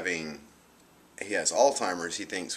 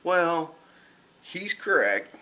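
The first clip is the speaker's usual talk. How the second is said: high, mock weary doctor voice; lightly voiced doctor, reluctant; sighing doctor; 10 semitones higher, much slower than usual. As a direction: put on the doctor's tired tone. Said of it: "he kinda sighs and he's like".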